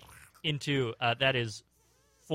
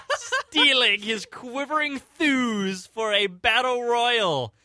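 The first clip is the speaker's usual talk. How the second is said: higher-pitched, grandiose storytelling; dramatic pulp narration; 7 semitones higher, 6 dB louder, slower than usual. A narrator's reading. Reading the battle to friends, grandiose narration. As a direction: declaim it like an epic showdown.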